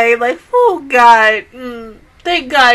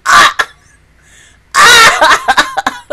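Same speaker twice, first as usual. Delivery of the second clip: high, loud fangirl squeal; excited squeal; 11 semitones higher, 6 dB louder than usual. Real, not acted. Fan squealing over her favorite characters together. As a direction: let out a delighted squeal.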